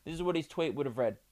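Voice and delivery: usual speaking voice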